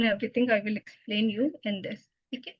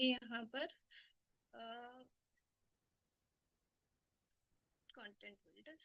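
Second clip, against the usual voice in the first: faint, far from the mic; very low voice, hard to hear; barely audible; 20 dB quieter than usual. Real, not acted. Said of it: "your voice is very low".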